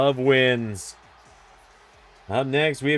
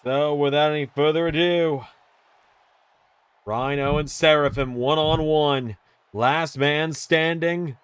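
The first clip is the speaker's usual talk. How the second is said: ring announcer; 3 semitones higher, slower than usual. Acted. Announces match start.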